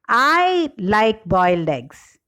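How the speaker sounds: I stretched and stressed at the start. Shown as a demonstration. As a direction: lean hard on the first word, I. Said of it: "the shift in the primary stress".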